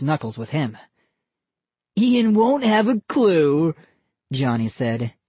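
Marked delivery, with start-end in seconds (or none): 2.0-3.7 put-on slow higher smug boy; smug kid voice. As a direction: gloat like a prankster kid. Boy character voice.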